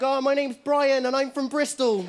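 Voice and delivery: a touch of a nervous, polite Brian; timid little contestant. Retold or imitated. Little Gladiators contestant introducing himself, meekly. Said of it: "the little guy looked like he'd be like".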